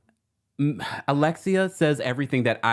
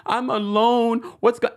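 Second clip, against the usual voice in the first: high, louder fake whimper; mock crocodile tears; 10 semitones higher, 4 dB louder than usual. Host mocking the castmate's crocodile tears, high fake sob. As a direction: parody her teary confessional. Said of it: "the crocodile tears that she gives".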